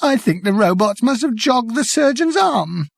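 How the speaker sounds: high, tearful voice; crying through the words. Acted.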